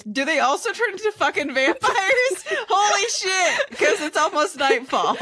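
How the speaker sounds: laughing through the words; can't hold back the giggles; breaking character giggling. Real, not acted. Players laughing at vampire twist.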